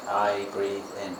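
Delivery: slow, low and soft meditation phrase; meditation cue. Shown as a demonstration. Teacher guiding students in breath meditation, slow and soft. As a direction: draw the words out softly with the breath.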